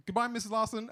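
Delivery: high, loud sing-song farewell; cheery goodbye. Retold or imitated. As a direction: sail out with a bright goodbye. Cheerful farewell to mum leaving.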